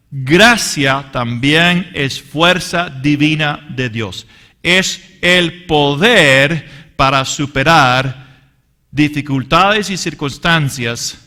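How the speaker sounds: slow, loud, emphatic preaching; preaching at full force. Acted. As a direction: drive each word home from the pulpit. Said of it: "preaching climax on grace". (predicación enérgica y lenta)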